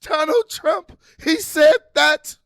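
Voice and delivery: hammed-up whining over what he said; mock flustered complaining. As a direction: play the frustrated voter griping about every quote. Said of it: "be like".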